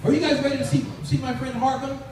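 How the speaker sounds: usual speaking voice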